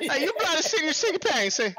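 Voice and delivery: high, hammed-up Spanish accent. Co-host copies the nickname, mock Spanish accent. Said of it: "in a Spanish accent".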